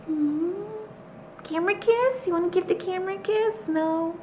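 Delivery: high sing-song pet voice; pet talk. Real, not acted. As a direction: coo at the cat in a high voice. Talking to the pet.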